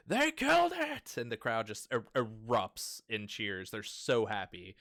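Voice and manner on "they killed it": high, joyful cry of triumph, slow; overjoyed shout. Acted. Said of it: "holds that thing up and goes".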